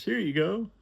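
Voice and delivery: high, breezy rich man; high client voice. Retold or imitated. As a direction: pitch way up and wave it off. John voiced handing over money.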